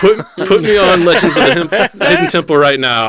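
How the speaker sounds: high, loud, laughing playful bravado; hyped-up demand. Pretend. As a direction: shout it like an eager game-show contestant. Plays along as eager contestant.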